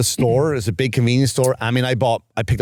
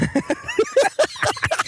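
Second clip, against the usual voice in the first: high, piercing burst of laughter; shrieking laugh. Real, not acted. Laughing at his own urge to leave, shrieking laugh. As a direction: cackle at your own bad idea. Wanting to walk out on her.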